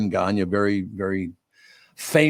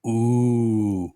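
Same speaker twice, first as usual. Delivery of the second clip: unimpressed ooh. Retold or imitated. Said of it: "There was a long pause".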